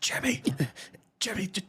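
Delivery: hushed, breathy alarm; urgent whisper. Acted. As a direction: whisper it urgently, half laughing. Hushed alarm over a smell.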